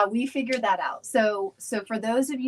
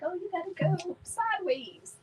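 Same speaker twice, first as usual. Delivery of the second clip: high, softer quoted advice; small raised voice for someone else's suggestion; imitated viewer; 8 semitones higher, 6 dB quieter than usual. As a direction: mimic a viewer piping up lightly. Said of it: "Katie was like".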